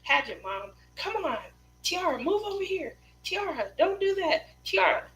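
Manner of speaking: high-pitched imitation of a bossy pageant mom. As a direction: play the fussing pageant mom. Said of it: "turned into that mom".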